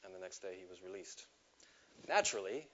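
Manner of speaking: ordinary talk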